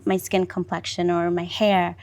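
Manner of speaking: ordinary talk, nothing marked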